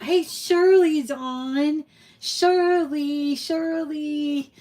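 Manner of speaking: high, loud, drawn-out happy call; delighted greeting. Real, not acted. Host spots a missed friend on the live show, delighted greeting. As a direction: sing out the friend's name in real delight.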